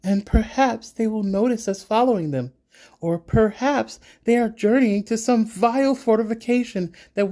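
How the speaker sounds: loud, high, insistent rebuttal; heated arguing. A narrator's reading. Siba demanding an ambush now, heated.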